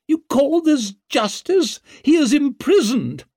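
high, loud aristocratic protest; indignant outrage. A narrator's reading. Lady Wetterland challenging the justice of her child's imprisonment, indignant. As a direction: protest hotly, pitch leaping up.

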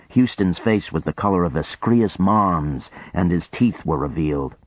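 ordinary delivery, nothing marked

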